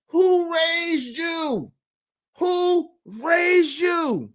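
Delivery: high, very slow, drawn-out rebuke; indignant scolding. Retold or imitated. Customer scolding the bank teller, drawn-out indignation. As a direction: stretch each word out in high-pitched outrage.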